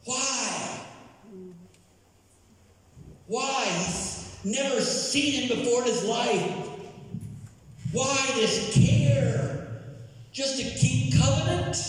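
high-pitched, impassioned storytelling sermon; excited preacher acting out the scene; preaching at full force. Real, not acted. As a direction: preach it high and urgent, building suspense. Preacher dramatizing a Bible scene.

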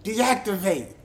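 high, loud robotic command; yelled command. Retold or imitated. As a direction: bark it at the cop like a machine order. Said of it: "He yelled out to a cop".

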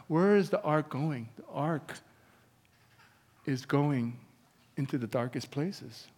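normal talking voice

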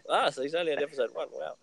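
usual speaking voice

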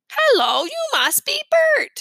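very high, slow puppet voice; Sesame Street voice. A narrator's reading. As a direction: greet him like a TV puppet. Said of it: "in his best Sesame Street voice".

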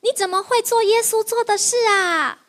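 a hint of an amazed boy; happy little boy. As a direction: marvel like a delighted kid. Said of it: "很开心地说".

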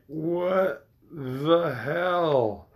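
slow, drawn-out mock disbelief; stunned disbelief. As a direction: stretch it out like a viewer in shock. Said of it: "And you're like".

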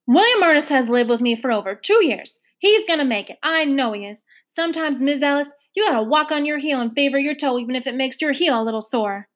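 determined Trotter arguing back; earnest foster mother. Acted. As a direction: press her point home. Trotter defending her boy.

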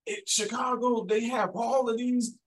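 high, exaggerated questioning voice of a nosy stranger; retelling how new people in Texas quiz him about Chicago, in a high mock-curious voice; mimicking curious locals. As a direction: pitch it way up like a wide-eyed outsider asking. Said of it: "And then I get that".

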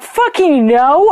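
indignant shout of refusal; emphatic no. Retold or imitated. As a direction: stretch out a flat-out no. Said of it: "And I'm like".

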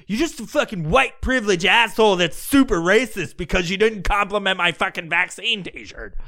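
hammed-up outrage, piling on the blame; mock indignant rant. [name] scolds partygoer over his vaccine t-shirt, mock indignant.

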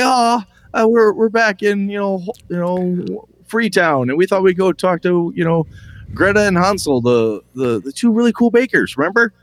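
high-pitched, hesitant player jogging a memory; eager player. Acted. Player reminds the game master of the baker voices, high and eager. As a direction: put on a high voice, groping for the names.

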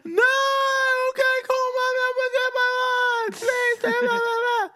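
put-on very high crying, pleading kid; wailing child. Son crying and begging his dad. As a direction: beg and wail like a distraught boy. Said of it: "He's like".

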